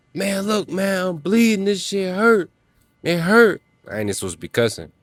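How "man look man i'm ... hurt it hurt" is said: high, pained hammed-up pleading; hurt man pleading. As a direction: play the injured guy begging, voice up high. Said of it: "And they say".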